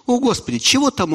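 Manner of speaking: high alarmed gasp; startled and high. Acted. Visitor alarmed by death.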